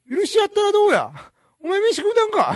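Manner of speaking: comic mimicry. Co-host tests whether his impressions sound alike, high-pitched mimicry. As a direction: squeeze out the catchphrases in one high comic tune. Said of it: "イントネーションが".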